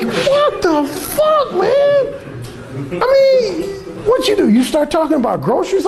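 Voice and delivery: imitating a high-pitched cry of disbelief; drawn-out mock squeal, then baffled high questioning; astonished brother. Brother to the teller about the woman, astonished.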